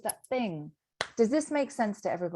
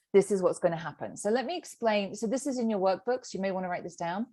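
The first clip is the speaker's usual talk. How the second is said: ordinary talk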